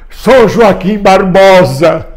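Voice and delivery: drawn-out rallying cry; imitated protest shout. Man recalling his street chants to viewers, mock shouting. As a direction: call the name out slowly, as at a rally. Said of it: "eu gritava assim".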